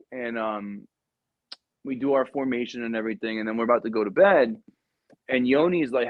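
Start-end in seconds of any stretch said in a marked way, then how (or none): none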